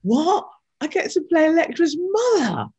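high, loud squeal of disbelief; thrilled disbelief. Retold or imitated. Actress retelling the job offer, squealing in disbelief. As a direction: shriek it like you can't believe your luck.

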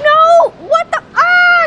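high, loud alarmed cries; panicked shouts; panicked yell. Real, not acted. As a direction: yelp in panic. Panic at game hazard.

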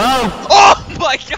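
high, loud, drawn-out exclamation; excited cry. Real, not acted. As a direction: cry out in delighted surprise. Startled at finding the others.

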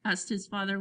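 ordinary delivery, nothing marked

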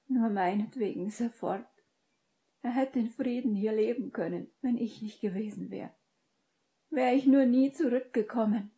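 high-pitched, distraught character voice; anguished self-blame. A narrator's reading. Sobbing character blames herself.

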